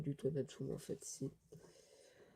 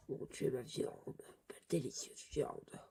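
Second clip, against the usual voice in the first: softer, whispered craving; hungry whisper; 5 dB quieter than usual. Acted. Starving Sting mistaking friend for meat, hungry whisper. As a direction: whisper as if entranced by food.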